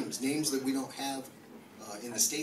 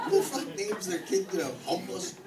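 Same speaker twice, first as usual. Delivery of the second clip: ordinary delivery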